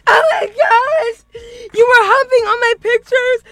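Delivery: high, loud, slow mock-crying wail; dramatic wailing. Retelling catching him, she wails high and loud in mock tears. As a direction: ham up the heartbreak, wailing. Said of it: "I'm getting dramatic".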